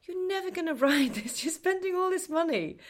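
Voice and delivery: high, laughing mock husband voice; teasing impression of a sceptical husband; imitating husband. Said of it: "My husband's like".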